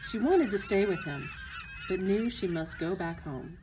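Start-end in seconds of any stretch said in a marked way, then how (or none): none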